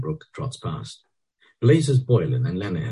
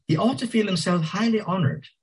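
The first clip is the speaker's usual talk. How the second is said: high haughty lady voice; haughty woman; 14 semitones higher than usual. A narrator's reading. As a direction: look down on him, high.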